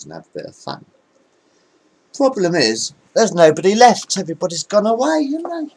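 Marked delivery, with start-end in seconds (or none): 2.1-5.7 higher and louder, speeding up; rising comic whine. Real, not acted. To a bored crowd, a rising squeaky lament. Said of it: "comic rant ending".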